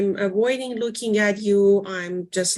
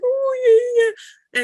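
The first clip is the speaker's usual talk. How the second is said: put-on very high, loud baby talk to a dog; high cooing baby voice for a pet; 5 dB louder, much slower than usual. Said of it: "talking to her dog with baby voice".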